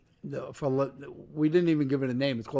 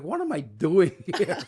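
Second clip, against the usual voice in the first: half-laughing, asking himself in disbelief; a rueful laugh through the question; 4 semitones higher than usual. Retold or imitated. Soaked by a passing cab, laughing at himself.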